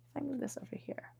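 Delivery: breathy murmur to herself while moving cards; whispered aside. Real, not acted. Reader muttering to herself while shuffling, whispered. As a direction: whisper it under your breath.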